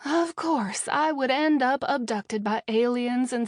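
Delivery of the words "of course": usual speaking voice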